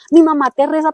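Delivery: pleading prayer. Retold or imitated. Praying to the Lord in tears.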